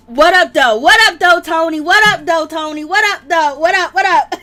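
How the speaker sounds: loud Detroit "what up, though"; Detroit accent. Shown as a demonstration. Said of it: "I forgot how they say it in, um, Detroit".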